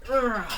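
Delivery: loud, high effort grunt; straining grunt. Acted. Fango pushes down a pine tree, straining grunt. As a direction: grunt with a big heave.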